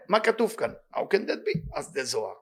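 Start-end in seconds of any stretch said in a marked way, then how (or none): 1.0-1.6 incredulous. Acted. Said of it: "rhetorical disbelief".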